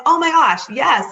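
high and loud, overjoyed gasp of relief; relieved excitement. Retold or imitated. Thanking the young man with the scraper, high loud relief. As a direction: grab the lifeline, thrilled.

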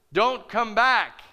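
slow, loud, heavy words from above; emphatic command. Retold or imitated. Preacher voices God's command to the church, slow and loud. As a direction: lay it down as a firm order. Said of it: "He told us".

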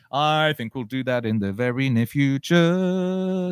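slow, high and loud, held final word; grand drawn-out announcement. Pretend. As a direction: proclaim it theatrically, stretching the words.

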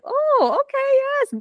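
high surprised squeal. Retold or imitated. Excited reaction to the song.

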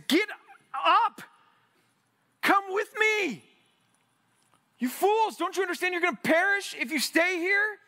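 high, loud shouting to flee the sinking ship; urgent shout. Pretend. Passenger shouting at diners on sinking ship, high loud yell. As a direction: yell at them to get up and run. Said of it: "you're gonna get, say".

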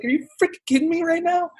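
laughing can't-believe-it squeak; stunned delight. Retold or imitated. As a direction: squeal it out in happy shock. Retelling hearing his own name.

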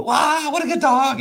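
high, loud, drawn-out praise; big, slow burst of praise; excited dog praise. Acted. Voices trainer praising the dog.

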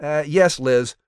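ordinary talk

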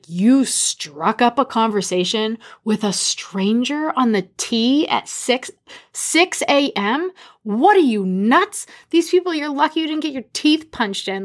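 are-you-nuts astonishment at a reckless husband; incredulous scolding. Retold or imitated. Wife to husband who chatted up a stranger, incredulous.

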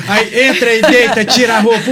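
ordinary talk, nothing marked

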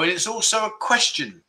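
ordinary talk, nothing marked

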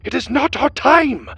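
high, loud protest; desperate cry. A narrator's reading. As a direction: cry out as you are dragged away. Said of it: "the man cried".

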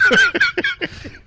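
sudden high laugh bursting out; high burst of laughter. Real, not acted. Host cracking up at his own made-up fact, high laugh.

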